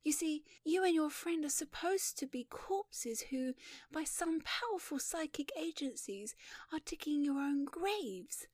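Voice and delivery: high, airy feminine voice; light voice, slowing at the end; earnest young heroine. Acted. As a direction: explain the plot sweetly and eagerly. Shy heroine explains film plot.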